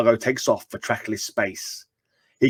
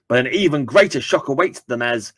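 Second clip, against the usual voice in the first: ordinary delivery, nothing marked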